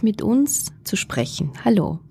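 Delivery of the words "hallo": ordinary talk, nothing marked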